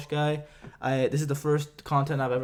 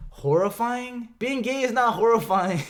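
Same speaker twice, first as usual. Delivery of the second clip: incredulous disbelief; 8 semitones higher than usual. Real, not acted. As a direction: throw the word back in disbelief. Incredulous rebuttal of a comment.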